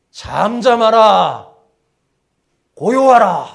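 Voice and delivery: loud, slow, drawn-out order; rebuking command. Acted. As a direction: command the wind and sea to be still. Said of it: "바람과 바다를 꾸짖었어요". (바람과 바다를 크게 꾸짖듯 명령)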